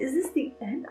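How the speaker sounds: ordinary talk, nothing marked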